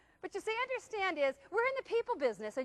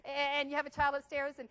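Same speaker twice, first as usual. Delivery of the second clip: ordinary talk, nothing marked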